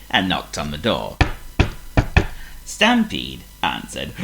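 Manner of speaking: plain reading voice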